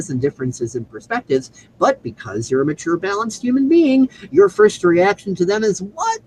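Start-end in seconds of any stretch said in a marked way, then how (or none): 1.8-6.2 rising high through "balanced human being" to a questioning "what?"; prompting quiz. Real, not acted. Speaker quizzing listeners on a repeated refrain, prompting with a raised "what?".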